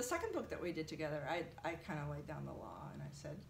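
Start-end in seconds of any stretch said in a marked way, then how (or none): none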